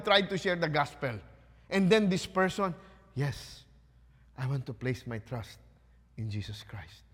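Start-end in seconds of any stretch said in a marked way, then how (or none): 3.1-7.0 meek convert. Acted. New believer accepts the gospel.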